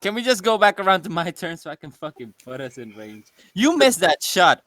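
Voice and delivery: usual speaking voice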